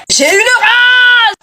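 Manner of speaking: slow, drawn-out comic fury; laughing mock rage. Real, not acted. Furious that Stéphanie giggles, slow laughing rage. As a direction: stretch out the outrage with a laugh.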